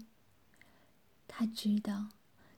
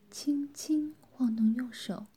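ordinary delivery

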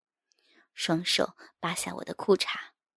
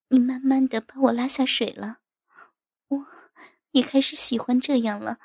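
higher-voiced worried wife; troubled wife; 6 semitones higher, 4 dB louder than usual. A narrator's reading. Wife confiding her worry to her husband, troubled. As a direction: sigh out the worries in a wife's higher voice.

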